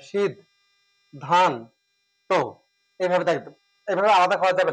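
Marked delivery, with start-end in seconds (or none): 0.0-2.4 সিদ, ধান, তো spaced out as three beats; syllable by syllable. Shown as a demonstration. Teacher showing how to write it, সিদ, ধান, তো spaced apart. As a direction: dictate সিদ, ধান, তো piece by piece.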